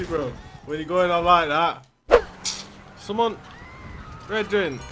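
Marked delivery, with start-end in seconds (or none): none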